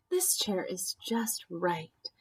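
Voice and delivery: pleased and cosy, quietly; smiling. Acted. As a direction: say it with a smile, settling in. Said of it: "she smiled".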